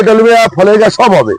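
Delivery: ordinary talk, nothing marked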